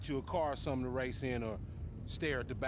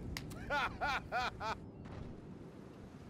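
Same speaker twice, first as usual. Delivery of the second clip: amused laugh; 8 semitones higher, 4 dB quieter than usual. Acted. Laughs at his own joke.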